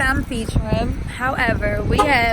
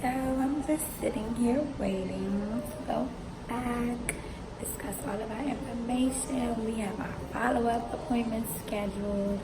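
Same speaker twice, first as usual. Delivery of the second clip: hushed talk; 2 semitones lower, 12 dB quieter than usual. Real, not acted. Waiting room, talking softly.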